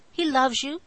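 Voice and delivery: usual speaking voice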